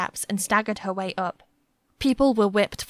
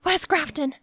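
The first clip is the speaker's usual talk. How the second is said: gasped question; 7 semitones higher than usual. A narrator's reading. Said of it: "she gasped".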